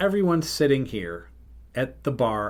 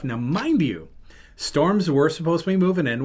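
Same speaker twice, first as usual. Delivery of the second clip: ordinary delivery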